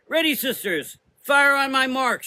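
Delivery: high, loud battle command; rally the troops; shouted order. A narrator's reading. Mother Azra commanding her riflemen, loud shout. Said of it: "shouted Mother Azra".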